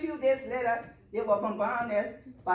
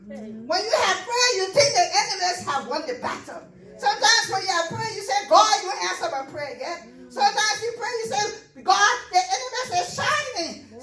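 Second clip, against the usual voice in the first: preaching at full force; 6 semitones higher, 4 dB louder than usual. Acted. Preacher building again.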